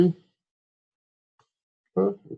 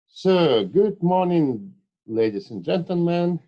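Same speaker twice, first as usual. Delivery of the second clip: higher and louder, addressing the whole hall; formal lecture greeting; 7 semitones higher, 5 dB louder than usual. Real, not acted. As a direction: open the lecture with a bright formal welcome. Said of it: "formal opening of the lecture".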